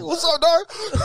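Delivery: high, hammed-up taunt; re-enacted yelled taunt; yelling loud. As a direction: throw it out high like a challenge. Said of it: "I started yelling loud".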